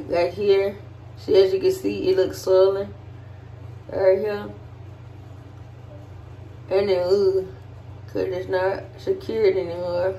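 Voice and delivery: speaking with mouth stretched open; cheek pulled aside by finger. Real, not acted. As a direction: lips held apart by hand. Seen 0: Finger hooks mouth corner sideways, showing braces, through most of the words.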